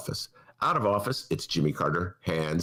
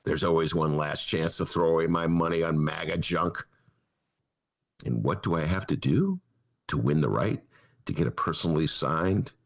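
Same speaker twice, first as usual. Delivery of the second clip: ordinary delivery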